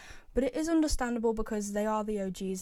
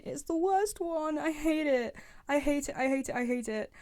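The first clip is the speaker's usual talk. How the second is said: higher-pitched venting, repeating it in a rush; fed-up whine, pitch raised then sliding down; exasperated rant; 4 semitones higher than usual. Real, not acted. Gamer venting about a hated voice line, exasperated.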